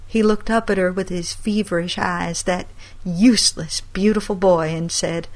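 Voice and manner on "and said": usual speaking voice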